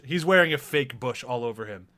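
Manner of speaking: ordinary talk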